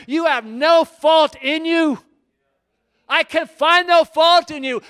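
driving, emphatic pulpit delivery; full-force preaching. Acted. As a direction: proclaim it to God at full power. Preaches David's praise at full force.

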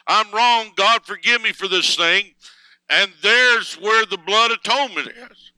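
ringing revival-style reading; quoting a preacher. Retold or imitated. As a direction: deliver the quote like a fervent preacher. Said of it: "he says".

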